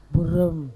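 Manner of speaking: very low "Berem" from deep inside; pelafalan Sunda bertenaga dalam. Shown as a demonstration. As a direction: drop "Berem" far down in pitch. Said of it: "ngomong bahasa Sunda pakai tenaga dalam". ("Berem" rendah sekali, pakai tenaga dalam)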